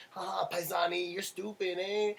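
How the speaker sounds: high mocking singsong; taunting kids. Mimicking the kids who mocked him after he hit the pole, high and taunting. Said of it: "They're like".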